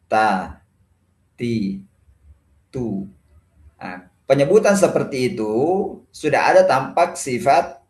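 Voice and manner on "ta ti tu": TA, TI, TU with a clean t and slight breath; separate, unexaggerated TA, TI, TU; ordinary ta. Shown as a demonstration. Teacher modelling the right way, plain light-breathed t. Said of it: "cukup dengan biasa aja".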